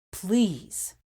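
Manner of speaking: ordinary delivery, nothing marked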